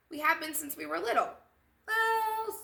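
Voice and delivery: put-on high, loud girlish chorus; two girls in unison. Cookie and Milk answering together, gleeful. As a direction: shout it together like best friends.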